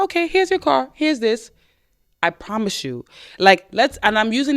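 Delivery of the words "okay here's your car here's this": higher, airy nonchalant handover; breezy nonchalant. Pretend. Woman handing the car back to her ex, breezy and nonchalant. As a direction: hand it over like it's nothing. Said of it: "You're like".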